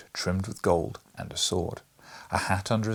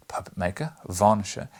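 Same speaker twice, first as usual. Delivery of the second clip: usual speaking voice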